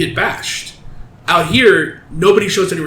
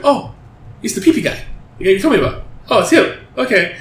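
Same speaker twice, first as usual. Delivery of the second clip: higher-pitched mock realization; dawning recognition; 4 semitones higher, faster than usual. Brother-in-law recognizing the man he heard about, lightly surprised. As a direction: act out a sudden little "oh, it's him".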